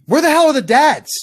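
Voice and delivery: loud, high demand; angry outburst. Real, not acted. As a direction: demand an answer, fired up. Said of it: "now it's my turn to scream at you".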